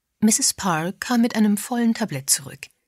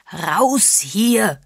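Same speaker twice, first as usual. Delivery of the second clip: louder, higher furious roar; bellowed; 4 semitones higher, 5 dB louder than usual. A narrator's reading. Mr Parr interrupts Agatha to throw her out, bellowing. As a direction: bellow the order in a rage. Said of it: "wiederholte er brüllend".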